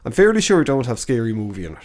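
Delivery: ordinary delivery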